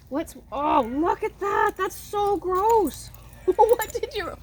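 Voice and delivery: high-pitched pet voice, teasingly disgusted; pet talk. Real, not acted. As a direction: coo up high like scolding a puppy fondly. Cooing at dog rolled in poo.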